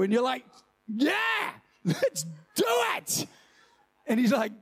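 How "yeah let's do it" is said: much higher, hammed-up shout of zeal; over-the-top enthusiastic believer; fired-up eager reply. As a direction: yell it like you can't wait to go. Eager believer answers God.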